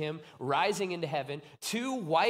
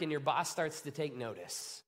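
ordinary delivery

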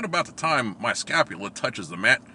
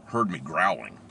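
ordinary delivery

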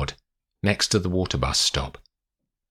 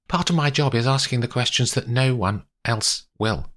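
lightly voiced lawyer, higher-pitched; composed lawyer; 7 semitones higher than usual. Olivia justifies her hard question to Paddy, composed. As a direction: explain it firmly to an upset client.

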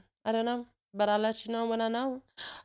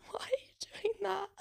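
high, breathless whisper through a laugh; 12 semitones higher, 9 dB quieter than usual. Acted. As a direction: whisper it in disbelief. Baffled by adults on scooters.

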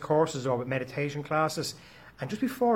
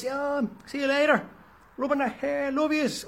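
put-on fond goodbye to kids; 8 semitones higher than usual. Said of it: "hugging the boys".

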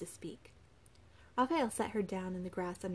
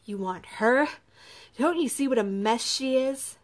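loud, scornful challenge; contemptuous; 5 semitones higher, 10 dB louder than usual. A narrator's reading. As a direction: sneer it out loudly at a rival. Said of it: "panting and looked on contemptuously".

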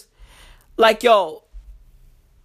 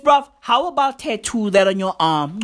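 usual speaking voice

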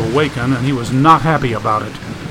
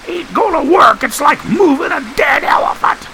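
much higher strained yell; Staples shouting; 13 semitones higher than usual. Acted. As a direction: complain at full voice into the wind.

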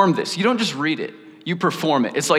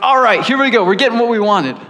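excited mock cheer, higher and louder; eager Corinthians; 7 semitones higher, 7 dB louder than usual. Corinthians receiving Paul's letter, imitated with loud excitement. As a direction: imitate a crowd gleefully getting its way. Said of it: "they're probably like".

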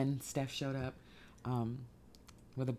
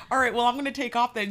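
quick, high and flustered; fast and high; 9 semitones higher, 10 dB louder, faster than usual. Retold or imitated. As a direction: rush it out, higher and louder. Retold awkward exit line.

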